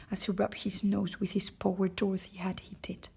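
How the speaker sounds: usual speaking voice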